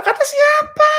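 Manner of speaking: very high-pitched comic scoff; hammed-up falsetto disbelief; mocking retort. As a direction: squeal the challenge back mockingly.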